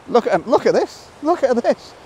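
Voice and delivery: high-pitched, thrilled urging; can't contain the excitement, pitch leaping up; real excitement. Real, not acted. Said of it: "the excitement hopefully comes across".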